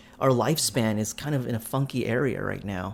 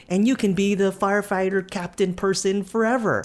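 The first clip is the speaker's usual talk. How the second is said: put-on defiant child; 7 semitones higher than usual. Child talking back to father.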